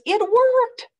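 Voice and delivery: very high, loud joy; delighted Gerald. Acted. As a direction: burst out happily it worked.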